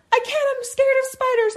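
high, loud frightened plea; mock scared whimper. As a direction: imitate a friend too afraid to help. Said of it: "And she goes".

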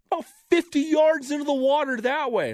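high called answer; calling out. Retold or imitated. Friend calling up where the ball went. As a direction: yell the bad news up the hill.

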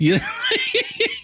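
short squealing laugh; high laugh. Real, not acted. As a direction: let a high giggle escape. Laughs at own joke.

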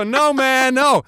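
high, loud mock protest; protesting frat boys. Frat-boy wrestlers refusing Bruno, loud protest. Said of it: "They go".

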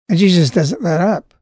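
ordinary talk

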